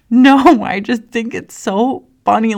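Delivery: a loud laughing no, then giggly; laughing denial. Retold or imitated. Answering husband that it's funny, loud laughing denial. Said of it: "And I was like".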